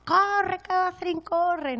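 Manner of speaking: high-pitched, drawn-out urging cry; imitating a trainer's shout; retold shout. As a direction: yell it out like a coach urging a runner on. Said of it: "Y le grita".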